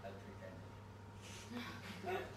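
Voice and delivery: soft breathy aside, slowed right down; wistful whisper. Acted. Comedian musing to the audience, whispered aside. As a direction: sigh it out like a lost era.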